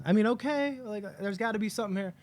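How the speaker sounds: high-pitched, hesitant acceptance; doubtful fan. Acted. Acts out a doubtful fan's reaction.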